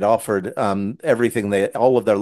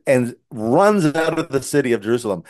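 ordinary delivery

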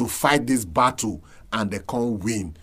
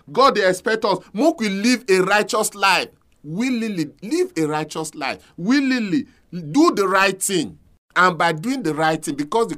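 raised, high-pitched exhortation; impassioned preaching; 7 semitones higher, 3 dB louder than usual. Real, not acted. Preacher urging church to live righteously, impassioned. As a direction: press each phrase home like a preacher. Preaching rises to full force.

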